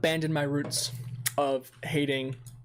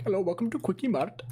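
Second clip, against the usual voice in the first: higher, hammed-up Indian accent; 5 semitones higher than usual. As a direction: imitate a cartoon Indian shopkeeper accent. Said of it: "of a Indian accent".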